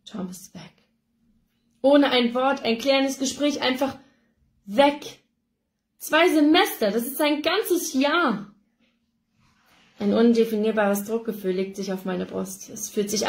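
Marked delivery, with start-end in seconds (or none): 0.1-0.7 barely audible, numb; stunned. A narrator's reading. Stunned inner realization. 1.8-8.5 higher and louder, aghast; rising disbelief. A narrator's reading.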